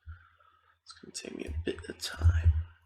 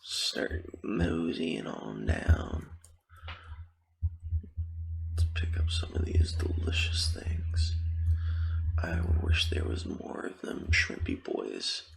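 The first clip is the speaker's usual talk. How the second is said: hushed, mostly voiceless game commentary; ASMR whisper; 3 semitones lower than usual. Real, not acted. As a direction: whisper casual gameplay chatter to the listener.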